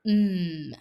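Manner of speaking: a long hummed mmm with lips closed; isolated mm sound. Shown as a demonstration. Teacher demonstrating the mm sound to the class with a long hummed mmm. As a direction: hum the mm sound on its own, lips together. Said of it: "listen to the sound at the beginning".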